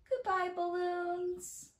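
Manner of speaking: high, drawn-out goodbye; slow, high singsong send-off; storytime farewell. Acted. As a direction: wave off the balloons in singsong. Farewell to balloons in the rhyme.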